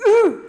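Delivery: loud U forced out with clenched effort; big straining Russian U from the diaphragm; squeezed, strained vowel. Shown as a demonstration. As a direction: clench and grunt out the U. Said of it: "apriete ese culo".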